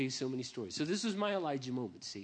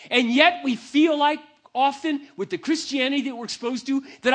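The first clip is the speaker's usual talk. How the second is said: loud, high, slow preaching at full force; fervent sermon climax; 10 semitones higher, 12 dB louder, slower than usual. Real, not acted. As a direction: drive it home from the pulpit.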